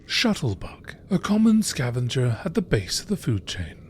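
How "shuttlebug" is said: ordinary delivery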